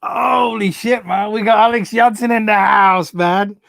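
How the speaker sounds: high, loud burst of delight, slowed on the first words; excited shout-out. Real, not acted. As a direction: greet a friend who just showed up, thrilled.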